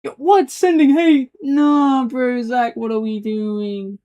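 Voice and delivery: indignant disbelief. Real, not acted. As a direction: cry out like you've been wronged, then drag it out. Reacting to being sent hate.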